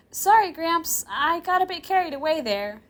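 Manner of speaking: put-on high girl voice, offhand apology; matter-of-fact. Girl apologizing to her grandfather, matter-of-factly. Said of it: "she apologizes matter-of-factly".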